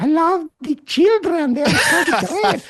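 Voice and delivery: high, slow mock Melania-style Slovenian accent; drawn-out, invented Eastern European accent, sweetly hammed up. Guest parodies Melania at a fundraiser, mock Slovenian accent. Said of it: "The Melania accent is not a real accent".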